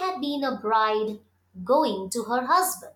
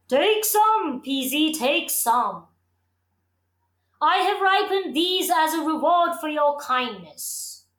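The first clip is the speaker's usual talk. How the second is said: a hint of a weary tree; heavy laden tree voice; groaning tree voice; 3 semitones higher than usual. A narrator's reading. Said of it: "groaned the laden tree".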